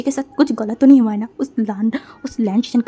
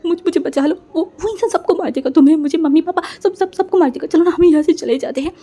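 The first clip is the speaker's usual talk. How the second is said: high, loud tearful pleading through sobs; crying and pleading; 6 semitones higher, 5 dB louder than usual. Acted. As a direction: beg to be saved while crying, voice pitched up. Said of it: "उसे रोते रोते बोला". (रोते हुए, ऊँची आवाज़ में गिड़गिड़ाना)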